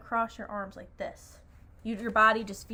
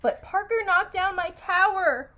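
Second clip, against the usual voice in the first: high, loud storybook protest; raised, much higher acted complaint; indignant shout; 9 semitones higher, 7 dB louder than usual. A narrator's reading. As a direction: shout it like an upset child character. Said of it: "Riley shouted".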